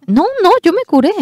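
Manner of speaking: high, loud and fast declaration; a preacher proclaiming he was healed; emphatic insistence. Acted.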